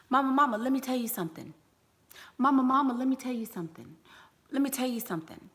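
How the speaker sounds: put-on child pestering mama. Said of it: "will say".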